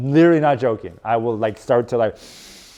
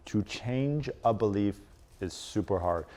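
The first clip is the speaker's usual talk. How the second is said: much softer and slow, every word weighed; quiet, slow emphasis; 4 semitones lower, 10 dB quieter than usual. Shown as a demonstration. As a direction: drop the voice to drive it home. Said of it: "I'll say that again".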